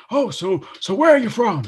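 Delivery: high, excited mad-scientist voice; Doc Brown impression. Retelling the actor at the ride, manic high Doc Brown voice. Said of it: "being a really good Doc Brown".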